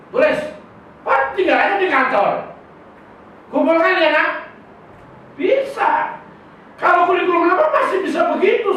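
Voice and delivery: high and loud, piling on; rising excitement, nearly shouting; excited teacher. Retold or imitated. A preacher reliving an easy classroom to the congregation, excited.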